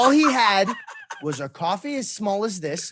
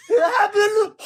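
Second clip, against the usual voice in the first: imitating a cheer shouted out the window, much higher and louder; mock new year cheer; 14 semitones higher, 8 dB louder, slower than usual. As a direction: yell it out the window then cut off. Friend yelling out the window.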